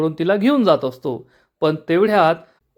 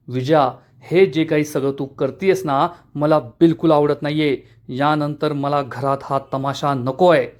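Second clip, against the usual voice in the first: plain reading voice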